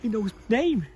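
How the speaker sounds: high, loud joyful disbelief; can't believe his luck; overjoyed. Acted. Zacchaeus thrilled Jesus is coming for tea.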